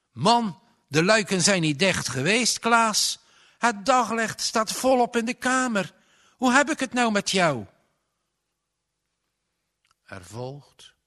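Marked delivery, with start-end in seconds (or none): 0.1-7.7 loud, much higher and fast in alarm; a father frightened into shouting; ontstelde vader. A narrator's reading. Father alarmed, scolding frightened son. (vader roept angstig, snel en luid)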